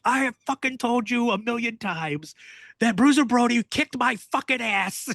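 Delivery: mock annoyed rant to a pestering friend; pissed-off Bull. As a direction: play the fed-up wrestler repeating himself. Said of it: "Bull would get so pissed off".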